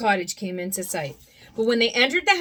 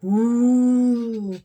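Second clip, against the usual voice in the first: long louder eerie hoot; ghostly owl hoot; 6 dB louder than usual. Acted. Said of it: "let out a ghostly".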